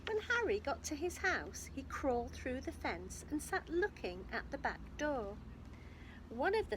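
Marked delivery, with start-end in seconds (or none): none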